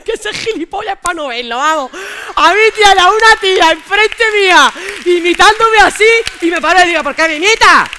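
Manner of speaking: loud, high-pitched animated retelling; worked-up, high shouting; incredulous outburst. Real, not acted. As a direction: shout it out, amazed and amused. Excited retelling of being imitated.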